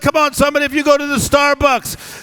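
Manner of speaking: high, loud fired-up preaching; full-force pulpit cry over music. Acted. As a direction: preach it to the rafters.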